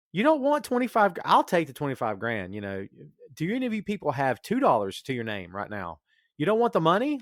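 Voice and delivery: high, loud, mock disbelief; incredulous. Mocking villagers refusing the cash, high incredulous. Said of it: "I'm like".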